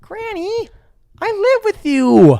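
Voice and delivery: squeaky high mock plea; high pleading voice. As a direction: whine it to granny in a high voice. Voicing himself pleading to grandma.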